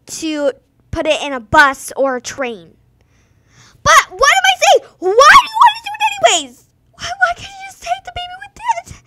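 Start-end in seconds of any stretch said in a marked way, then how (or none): 3.8-9.0 high, loud outraged yelling; outraged shout. Real, not acted. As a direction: shout it in disbelief. Ranting about mailing babies.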